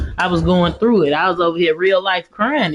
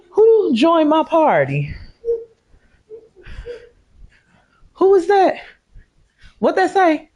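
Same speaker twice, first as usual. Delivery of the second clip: puzzled questions pitched much higher; surprised; 9 semitones higher than usual. Real, not acted. Surprised at someone joining her game party. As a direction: ask high and startled who that is.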